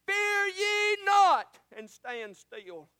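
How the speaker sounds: intoning scripture. Acted. Quoting Moses in sermon.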